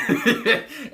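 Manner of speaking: loud high laugh at his own slip; real laugh after garbling the name; high burst of laughter. Real, not acted. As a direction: crack up at the name mix-up.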